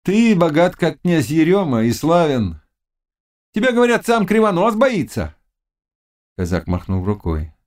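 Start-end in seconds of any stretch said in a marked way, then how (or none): 0.0-5.4 very high witch voice; flattering witch. Acted. Witch praising the Cossack's wealth and fame, high-pitched. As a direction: talk him up in a high pitch.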